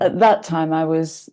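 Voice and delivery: normal talking voice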